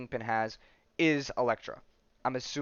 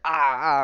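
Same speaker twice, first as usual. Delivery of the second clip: loud acted cries of headache pain; 2 semitones higher, 9 dB louder than usual. Prisoner seized by a headache mid-interview, loud pained cries. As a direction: cry out twice in mock agony. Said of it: "he starts getting that headache".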